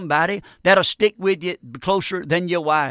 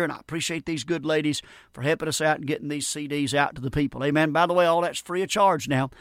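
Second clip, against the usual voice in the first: softer, quieter broadcast closing; radio sign-off; 3 semitones lower, 4 dB quieter than usual. Acted. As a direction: wind down gently like a radio host.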